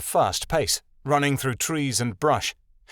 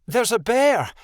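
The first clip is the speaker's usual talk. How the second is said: high, urgent warning; alarmed high-voiced appeal; pleading; 11 semitones higher, 4 dB louder than usual. A narrator's reading. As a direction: cry the warning, pitched up. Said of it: "Midnight Star pleaded".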